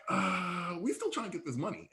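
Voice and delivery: softly voiced, hesitant mock reply; sheepish excuse. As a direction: imitate officials making a feeble excuse. Voicing reluctant Olympic hosts.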